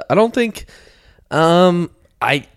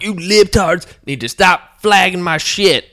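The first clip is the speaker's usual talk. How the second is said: mocking poster voice; 7 semitones higher, 3 dB louder, slower than usual.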